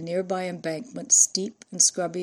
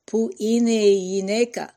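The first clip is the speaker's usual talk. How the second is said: beginner's Greek; 3 semitones higher, 6 dB louder than usual. A narrator's reading. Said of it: "asked in beginner's Greek".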